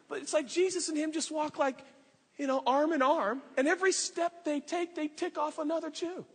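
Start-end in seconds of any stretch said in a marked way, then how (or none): none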